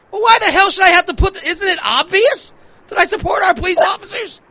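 high-pitched, indignant outburst; exasperated. Real, not acted. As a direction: fire back in high-pitched disbelief. Exasperated answer to Facebook demands.

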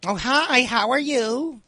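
high, sugary mock-polite hello; fake sweet greeting. To the smelly lady at the next table, high fake-sweet hello.

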